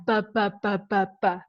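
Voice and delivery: repeated "b" as short separate sounds; isolated B sounds. Shown as a demonstration. Teacher showing alliteration in an example, isolated "b" sounds. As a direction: sound out "b" on its own, over and over. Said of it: "is alliteration".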